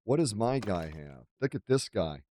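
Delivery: normal talking voice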